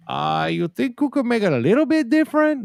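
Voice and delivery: lightly put-on Spanish-sounding accent, high; a hint of a conquistador. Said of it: "That sounded like a conquistador".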